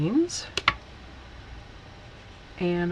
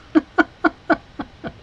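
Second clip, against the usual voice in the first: real laughter; 10 semitones higher, 9 dB louder than usual. Real, not acted. Laughing about aunt Peggy's text, hearty laugh. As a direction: burst of high, loud laughter.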